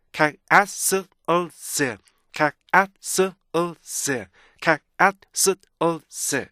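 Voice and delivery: 카스오스 chopped into ka, a, s, ul, z; "카스오스" in slow detached pieces; segmented castles. Shown as a demonstration. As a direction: punch out "카스오스" one beat at a time. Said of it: "이렇게 돼야 돼요".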